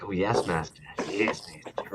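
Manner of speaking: lightly voiced servile hunchback; hunchback assistant. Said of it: "Homie's running around like".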